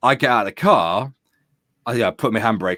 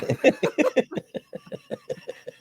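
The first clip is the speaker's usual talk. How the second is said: high laughter; 5 dB quieter than usual. Real, not acted. Laughs at own story.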